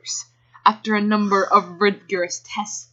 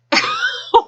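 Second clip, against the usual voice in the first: high burst of laughter. Real, not acted. Reader breaks up laughing.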